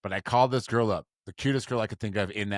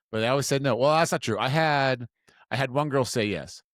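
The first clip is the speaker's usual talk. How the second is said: ordinary talk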